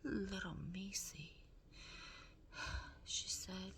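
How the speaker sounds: soft unvoiced whisper to the mouse; Meg whispering. A narrator's reading. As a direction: gently urge the mouse to run.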